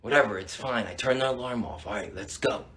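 low, soft, dismissive murmur; hushed. Acted. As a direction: quietly get moving again. Resuming sneaking out.

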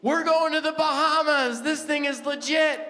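high, loud, thrilled yelling; excited shout. Retold or imitated. Wife shouting to husband about winning the trip, thrilled and loud. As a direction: burst out with the big news at full volume. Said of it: "She's like".

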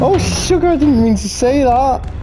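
high, prissy oops after a swear; mock prim apology. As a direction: play the shocked polite lady.

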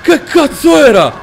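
high, very loud shriek of shock; real startled scream. Real, not acted. Player hit by a jumpscare in the game, startled scream. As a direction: scream the curse out in terror.

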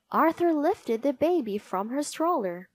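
ordinary delivery

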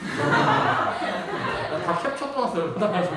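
loud laughing through the words; cracking up mid-sentence. Real, not acted. Laughing while bragging about screenshots.

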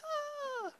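high, soft mock coo; mock swooning. Comedian acts couple meeting years later, soft mock swooning. Acting reunion of childhood friends.

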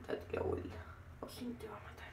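hushed warning whisper. Pretend. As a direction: breathe the warning, barely voiced. (susurro de advertencia)

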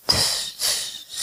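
soft voiceless double hiss; toad hissing. A narrator's reading. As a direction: hiss twice between the teeth. Toad's hissing tic before speaking.

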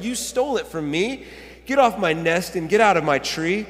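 loud acted accusation; indignant sputter. A narrator's reading. Maisie accuses Horton of stealing her egg, indignant. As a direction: order him off as the cross bird. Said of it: "she sputtered".

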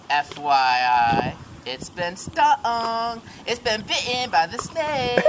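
very slow, drawn-out reveal; dramatic reveal. Acted. Narrator dragging out the badger's poisoning, very slow. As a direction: stretch each word out for suspense.

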